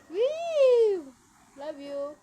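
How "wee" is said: high, loud happy wee; playful squeal. Real, not acted. Playing with baby. (masayang sigaw)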